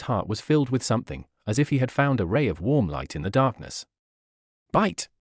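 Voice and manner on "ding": a sudden high ping of a word, like an alert tone; high system chime. A narrator's reading. The system alerts the host with a high ping. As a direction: chirp it out as a bright notification sound.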